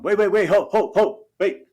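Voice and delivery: alarmed New York engineers calling wait; excited engineers. Retold or imitated. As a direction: urgently stop everyone, voice leaping up. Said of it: "we heard the engineers in New York City going".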